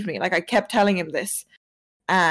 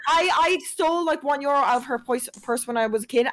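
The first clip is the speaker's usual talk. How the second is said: usual speaking voice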